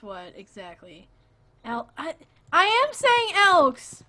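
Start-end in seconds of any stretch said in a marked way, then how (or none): 1.7-4.0 high, slow, loud protest; real exasperated yell on the edge of laughter; exasperated insistence. Real, not acted. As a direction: shout it out, stretching each word. Loud protest over teased pronunciation.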